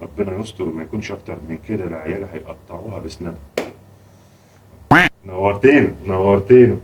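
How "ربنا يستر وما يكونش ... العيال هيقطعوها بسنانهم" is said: much softer, lower worried mutter; muttered aside. Acted. Father to himself about the children and their guest, muttered aside. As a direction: say it under your breath, low and worried.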